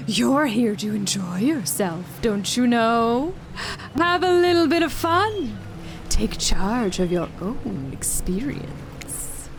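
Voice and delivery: slow, swooping voice of the sea; weird ocean voice. Acted. Ocean urges visitor to enjoy the beach, weird ocean voice. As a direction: draw it out, strange and coaxing. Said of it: "that voice again".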